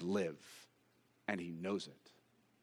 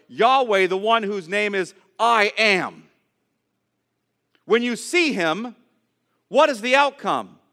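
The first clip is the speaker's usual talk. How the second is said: louder, higher fervent sermon climax; louder, higher preacher at full cry; full-force preaching; 5 semitones higher, 6 dB louder than usual. Acted. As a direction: drive it home to the congregation.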